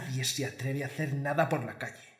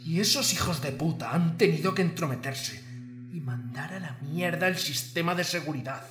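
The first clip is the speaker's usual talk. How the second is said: snarling punk. Acted. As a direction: spit the insult out. Boss ranting at his enemies.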